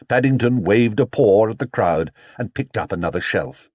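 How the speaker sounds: plain reading voice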